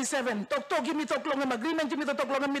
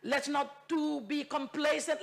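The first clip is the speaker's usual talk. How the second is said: usual speaking voice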